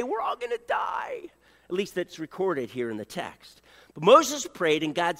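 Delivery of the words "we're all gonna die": high, hammed-up wail of doom; mock panic. Grumbling Israelites mock-wailing that they will die. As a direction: whine it like a panicked crowd.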